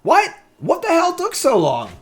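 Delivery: high, loud incredulous what; shocked and fed up; exasperated outburst. Real, not acted. Opponent took forever, exasperated outburst.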